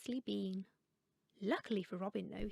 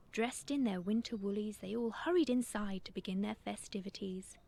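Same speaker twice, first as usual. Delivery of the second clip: ordinary delivery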